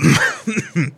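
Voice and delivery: parched throat cough; dry cough. Acted. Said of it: "excuse me".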